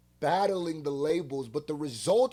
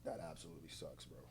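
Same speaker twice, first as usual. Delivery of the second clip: much softer, lower, subdued; quiet sympathy; 12 semitones lower, 20 dB quieter, faster than usual. Real, not acted. Hearing of Drake's dad's cancer, softly sympathetic. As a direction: drop the voice in sympathy.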